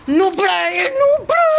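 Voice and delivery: high, loud crying wail; hammed-up wail at the noob; mock wail. Wailing at teammate.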